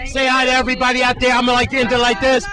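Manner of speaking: loud, upbeat TV reporter wrap-up; reporter sign-off. Acted. Reporter hands back to Jerome, loud upbeat sign-off. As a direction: wrap the segment with big on-air energy.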